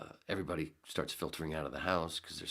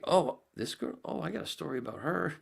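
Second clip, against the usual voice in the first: excited recognition; 11 semitones higher, 6 dB louder than usual. Retold or imitated. Recognizing girl in yearbook.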